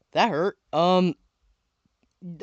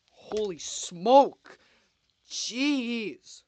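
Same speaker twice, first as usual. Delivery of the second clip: surprised exclamation; 5 semitones higher, 4 dB louder, much slower than usual. Real, not acted. Bottle fizzes over when opened.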